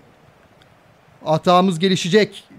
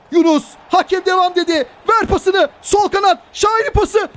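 rising excitement; 10 semitones higher than usual. Real, not acted. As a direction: pitch climbing, urgent play-by-play. Attack leads to goal. (heyecanı yükselen spiker)

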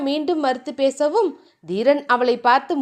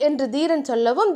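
ordinary delivery, nothing marked

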